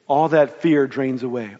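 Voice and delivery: ordinary delivery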